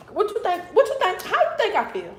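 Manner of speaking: acted-out outrage, voice jumping high; retold upset. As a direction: fire off exasperated questions, pitch leaping up. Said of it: "So I was highly upset".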